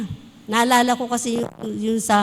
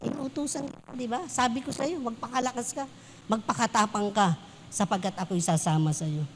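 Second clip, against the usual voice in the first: gentle reassurance; 5 semitones lower, 9 dB quieter than usual. Retold or imitated. God's words to Joshua voiced.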